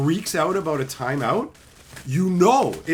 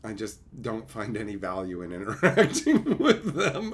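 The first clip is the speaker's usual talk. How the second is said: ordinary talk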